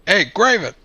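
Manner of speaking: high-pitched call across a distance; shout to him. Acted. Player's character spots Graven in the distance, shouting to him. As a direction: call out to get his attention. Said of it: "I'll shout to him".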